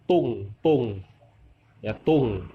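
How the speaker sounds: "tung" diucapkan dengan t beraspirasi, udara keluar dari mulut; pinyin pronunciation. Shown as a demonstration. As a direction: ucapkan "tung" dengan hembusan udara jelas, bukan "dong". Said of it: "bukan dibaca dong ya, dibaca tu". ("tung" dengan t beraspirasi, hembusan udara terdengar)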